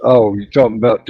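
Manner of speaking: normal talking voice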